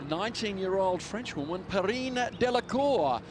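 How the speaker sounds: ordinary talk, nothing marked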